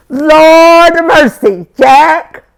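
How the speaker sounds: high, slow shouted alarm; drawn-out frightened shout, pitched high. Acted. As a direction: cry out in horror like a frantic mother. Mama voiced in alarm.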